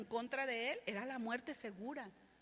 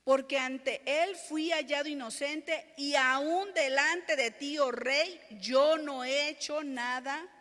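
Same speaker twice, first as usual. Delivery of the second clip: loud, raised-pitch declamation; scripture proclamation; 5 semitones higher, 11 dB louder, slower than usual. Acted. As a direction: declare innocence boldly before the king. Declaims Daniel's words from scripture.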